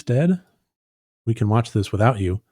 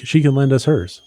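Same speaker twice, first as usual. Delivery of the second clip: ordinary talk, nothing marked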